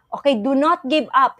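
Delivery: normal talking voice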